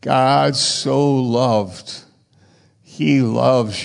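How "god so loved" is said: God so loved stretched out very slowly; slow emphatic reading. Shown as a demonstration. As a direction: hold each word, slow and weighty.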